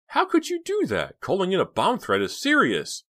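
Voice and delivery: high, loud, horrified scolding; aghast sister's outburst; shocked and appalled. Acted. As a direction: scold him in shrieking disbelief. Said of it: "shocked and appalled".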